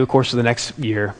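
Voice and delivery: normal talking voice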